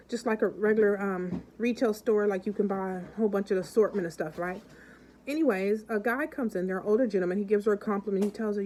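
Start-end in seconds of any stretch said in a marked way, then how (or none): none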